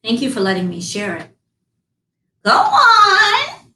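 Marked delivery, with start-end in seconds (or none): 2.4-3.7 high, loud crone cry; old beggar woman urging. Acted. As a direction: push her on in a piercing old witch voice. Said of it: "said the old beggar woman".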